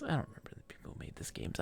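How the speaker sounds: rushed, breathy whisper aside; fast whisper. Real, not acted. Streamer whispering a quick aside to chat. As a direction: mutter it quickly under your breath.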